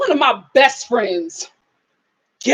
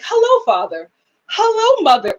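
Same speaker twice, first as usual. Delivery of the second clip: prim, lifted mock-proper voice; mock proper greeting; 8 semitones higher than usual. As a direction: parody her switched-up polite voice.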